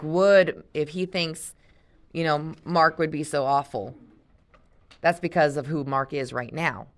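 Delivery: usual speaking voice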